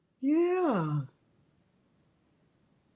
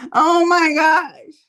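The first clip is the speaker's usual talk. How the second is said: laughing exclaim; 6 semitones higher, 8 dB louder, slower than usual. Real, not acted. Reacting to her own story.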